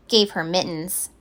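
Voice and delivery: ordinary delivery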